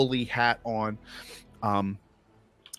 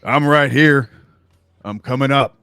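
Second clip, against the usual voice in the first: loud, raised call from another room; 5 semitones higher, 12 dB louder than usual. Acted. Dad answers from below deck, calling out. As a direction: project it up the stairs, high and loud. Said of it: "voice call out".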